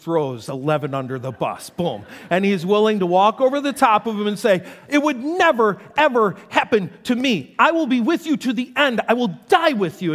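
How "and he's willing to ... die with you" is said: loud, high, insistent preaching; fervent preaching. Acted. Pastor voicing Peter's vow to Jesus, loud and insistent. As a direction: swear loyalty with full conviction.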